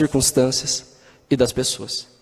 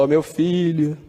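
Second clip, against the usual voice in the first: mock voice of God; slower than usual. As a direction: play God speaking down to a child, half-joking. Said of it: "ele fala". (voz de Deus imitada, de leve)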